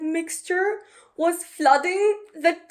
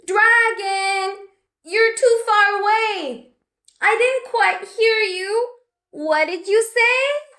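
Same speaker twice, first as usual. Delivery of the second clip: higher, louder sing-song call; cheeky little witch; 8 semitones higher, 4 dB louder than usual. Acted. Betty tricking the dragon from far below, higher sing-song. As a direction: call up teasingly.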